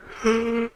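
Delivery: long audible yawn rising high. Real, not acted. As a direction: let out a big real yawn. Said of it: "Sorry, I'm yawning".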